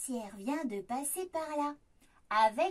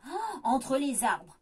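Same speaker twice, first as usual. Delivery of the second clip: usual speaking voice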